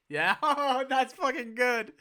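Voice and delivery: high, loud delighted laugh through words; cracking up at his own idea. Real, not acted. Pleased with the random story, laughing.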